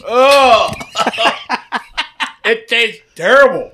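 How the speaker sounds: high, laughing groan of disgust. Real, not acted. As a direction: laugh through the bad taste. Just ate a gross bean.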